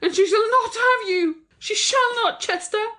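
high, possessive fury; jealous mother close to hysterics; furious cry. A narrator's reading. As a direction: forbid him in a very high, impassioned voice. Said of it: "cried Thyra furiously".

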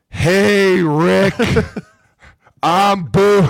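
loud high shouted hello, breaking into laughs; greeting the GM believed to be deaf, a loud high shout; shouting at a deaf man. Retold or imitated. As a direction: yell the greeting slowly as if he can't hear. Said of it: "you have to speak really loud".

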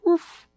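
high little bark; puppy woof. Acted. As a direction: yip like a tiny puppy. Puppy makes woof sounds.

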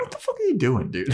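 very high incredulous squeal; shocked. Retold or imitated. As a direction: squeak it out in disbelief. Xander's shocked reaction retold.

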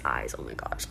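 soft whisper, voice gone; swooning whisper. Real, not acted. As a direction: whisper it as if melting. Swooning over his eyes.

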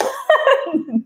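short high-pitched laugh. Real, not acted. Speaker amused at having to practise what she preaches, laughing. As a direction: laugh it off lightly.

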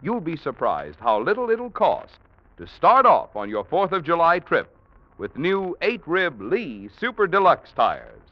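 ordinary delivery

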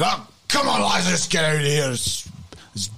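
high, exasperated call to his mates; annoyed. Acted. Flustered local to his lads, annoyed. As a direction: round up the lads in a huff. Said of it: "he was, like, really annoyed".

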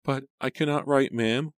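high, timid maid; small, meek servant girl; embarrassed. A narrator's reading. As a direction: confess shyly in a high little voice. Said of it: "she said, blushing".

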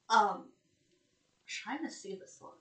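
ordinary talk

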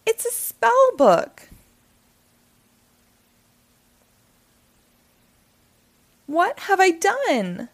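horrified. A narrator's reading. Cat horrified at the spell book mistake, high and slow. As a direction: wail it slowly in dismay.

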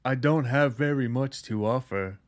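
lightly voiced humble caretaker; earnest, simple caretaker voice. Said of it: "he plays the simple caretaker".

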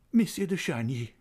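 bright, high glad greeting; cried gaily. A narrator's reading. Old lady greets the young viscount, gaily crying out. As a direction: call his name with delight. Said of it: "she cried gaily".

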